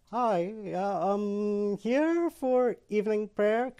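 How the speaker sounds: high, timid, drawn-out greeting; mock terrified. Nervous newcomer greeting a stranger at prayer, timidly. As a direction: play a scared, hesitant newcomer. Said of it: "I'm like, I'm terrified. I'm like".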